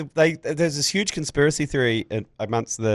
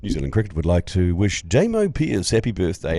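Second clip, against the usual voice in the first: lower, official ground announcer voice; fake PA announcement; 5 semitones lower than usual. Announcer making a fake birthday announcement, mock-official voice. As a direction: read out a formal birthday message, deadpan. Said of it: "fake birthday announcements".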